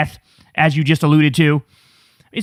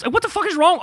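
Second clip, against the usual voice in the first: much higher disbelief; outraged; 10 semitones higher, faster than usual. Real, not acted. Streamer exasperated at a clip, much higher. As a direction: throw it up in disgust.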